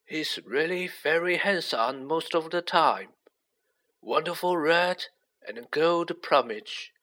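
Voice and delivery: put-on unhurried old mentor, pitched high; kindly old headmaster. A narrator's reading. Voices Dumbledore in story.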